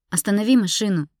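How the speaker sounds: sharp, insistent order; demanding. A narrator's reading. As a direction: demand that he stop at once. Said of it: "потребовала я".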